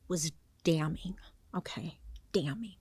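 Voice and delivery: hushed, breathy reveal of a damning word; dramatic whisper. Real, not acted. Telling viewers about the evidence in a dramatic whisper. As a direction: lean in and whisper it like a secret.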